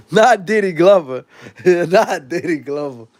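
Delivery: amused, laughing through the words. Real, not acted. Reacting to a viewer's comment, laughing. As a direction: chuckle as you repeat it.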